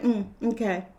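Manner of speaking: soft, low-key shrug of a reply; mock nonchalance; unbothered. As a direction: brush it off quietly. Acts the unbothered response.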